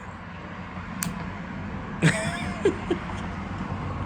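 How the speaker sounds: high airy laugh of amazement; giddy unvoiced laughter; breathy delighted laugh. Real, not acted. Amazed by the trigger reset.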